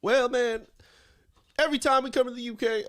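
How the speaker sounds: Chris Lytle impression. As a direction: kick off a mock fighter promo in someone else's voice. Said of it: "finish this up as Chris Lytle".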